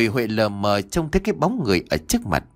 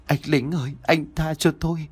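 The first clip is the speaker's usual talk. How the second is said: put-on high, pleading girl's voice; timid plea in a raised pitch; frightened begging; 5 semitones higher than usual. A narrator's reading. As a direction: beg the soldier for mercy. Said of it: "chắp vào nhau rồi bắt đầu lạy".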